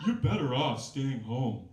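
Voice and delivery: a hint of a jealous, grouchy roommate; Marcy's voice. Roommate grumbling to her friend, lightly voiced jealous grouch. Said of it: "oh, I should do Marcy's voice".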